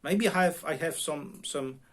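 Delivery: ordinary delivery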